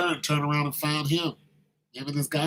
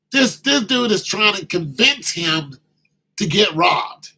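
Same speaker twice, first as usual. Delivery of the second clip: loud, higher indignant exclaim; outraged; 5 semitones higher, 7 dB louder than usual. Real, not acted. Comic to the audience, loud and indignant about the preacher. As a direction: sell the outrage to the crowd.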